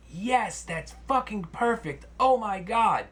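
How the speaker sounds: high, thrilled customer voice; excited customer. Retold or imitated. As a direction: squeal it with delight. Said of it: "he's like".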